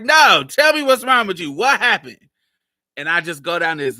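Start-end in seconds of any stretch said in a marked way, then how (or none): 0.0-2.2 loud, high-pitched insistent questioning; urgent demand. Retold or imitated. Stepdad to the crying boy, loud and urgent. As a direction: imitate a stepdad pressing hard for an answer.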